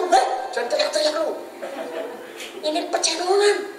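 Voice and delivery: lightly acted scolding. Telling the crying busker this is no church, lightly acted scolding.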